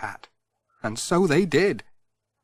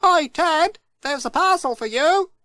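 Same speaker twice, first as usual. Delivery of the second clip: higher, calling across the hillside. Acted. Pat calls up the hill to Ted, mild shout. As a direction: hail Ted from the road. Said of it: "He stopped and shouted to Ted".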